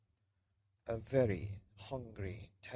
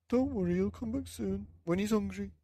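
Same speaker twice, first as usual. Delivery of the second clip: put-on high, louder old man; old man comforting; 8 semitones higher, 5 dB louder than usual. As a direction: soothe her in a high old voice.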